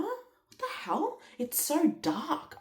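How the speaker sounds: high, slow, stunned; startled disbelief. Retold or imitated. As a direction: stretch out the shock. Her surprise at dark hair.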